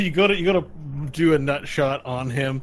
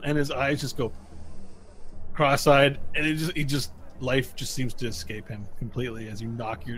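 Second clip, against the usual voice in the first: normal talking voice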